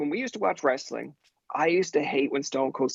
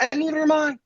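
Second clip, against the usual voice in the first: high-pitched defensive retort; mock indignant comeback; arguing back; 12 semitones higher, 3 dB louder, faster than usual. Acted family quarrel.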